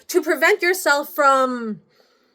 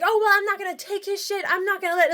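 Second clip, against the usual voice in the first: quick, higher-pitched mock indignation; imitated defiance; 5 semitones higher, 3 dB quieter, faster than usual. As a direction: imitate an ex refusing to back down. Acts out ex's angry defiance.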